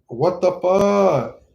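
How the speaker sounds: slow, drawn-out disbelief; incredulous. Retold or imitated. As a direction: stretch it like you can't believe it. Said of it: "And she's like".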